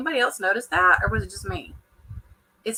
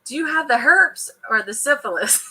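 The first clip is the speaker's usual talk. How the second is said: imitating a cast member's blunt question, raised pitch and louder; mock incredulous question; 5 semitones higher, 5 dB louder than usual. Recapping the show, mocking her question. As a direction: ask it like it's totally normal. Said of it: "when she was like".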